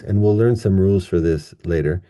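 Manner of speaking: usual speaking voice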